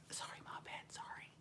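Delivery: hushed, voiceless aside; whispered apology. Real, not acted. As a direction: whisper a quick sorry to someone you forgot. Whispered apology for forgetting them.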